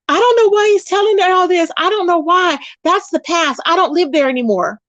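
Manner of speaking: imitating a yelling woman; mock yell. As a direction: mimic her yelling on her channel. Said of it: "Toxic is over there yelling".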